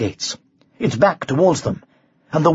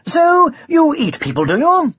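high, airy, casual question; breezy high-pitched knight playing for time; light conversational tone; 12 semitones higher, 4 dB louder than usual. A narrator's reading. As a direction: ask it lightly, as small talk. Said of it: "he said in a light conversational tone".

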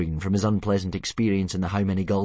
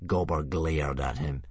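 plain reading voice

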